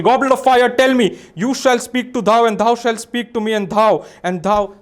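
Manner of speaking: mock incantation. Mentalist on stage chanting to the goblet. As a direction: intone it like a cheesy spell.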